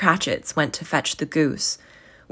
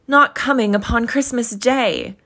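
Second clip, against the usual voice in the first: disappointed father; 6 semitones higher, 4 dB louder than usual. Acted. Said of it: "declension in his high spirits".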